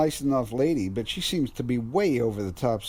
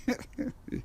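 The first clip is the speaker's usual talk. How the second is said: soft breathy laugh to himself; quiet airy laugh at the ghost talk; breathy scoff; 12 semitones higher, 10 dB quieter than usual. Acted. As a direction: scoff under your breath. Scoffing alone at spirits.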